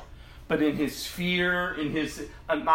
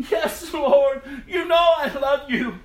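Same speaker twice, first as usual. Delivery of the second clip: loud, high, insistent answer; emphatic plea; 11 semitones higher, 7 dB louder than usual. Acted. Peter answering Jesus a third time, emphatic plea. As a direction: cry it out with raised voice.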